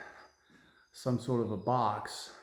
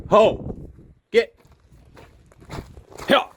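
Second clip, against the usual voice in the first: sharp, loud, high calls; herding calls; 11 semitones higher, 10 dB louder, slower than usual. Real, not acted. Calling the stray sheep off, sharp loud high calls. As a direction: bark short commands at the sheep.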